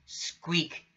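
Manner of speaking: plain reading voice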